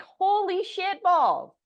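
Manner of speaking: high, slow, drawn-out disbelief; stunned. Real, not acted. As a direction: let the shock stretch every word. Realising what surfaced.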